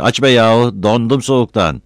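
impatient shout through a shut door. A narrator's reading. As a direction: holler to be let in. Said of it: "diye bağırdı".

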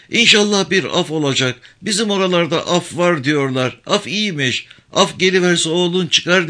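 put-on high, stubborn old woman insisting she will cry; defiant grieving mother. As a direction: protest the thirty-year sentence.

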